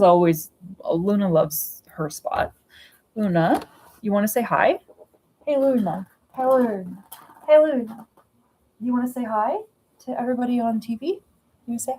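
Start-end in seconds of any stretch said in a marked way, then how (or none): 3.2-11.9 higher-pitched coaxing pet voice; talking to pet cat. Real, not acted. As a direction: call the cat over sweetly, voice lifted. Calling and coaxing her cat.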